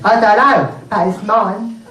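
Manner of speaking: lightly voiced small boy; a hint of a five-year-old boy; Billy. Boy answering his cousin in the bath, lightly childish. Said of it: "And Billy said".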